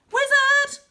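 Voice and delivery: very high, loud shout; loud high triumphant cry; excited shout. Acted. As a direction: shout it with delight.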